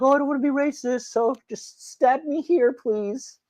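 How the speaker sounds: high, whiny mocking plea; sneering high-voiced parody of a meek victim; mock weak pushover. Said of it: "they just roll over like".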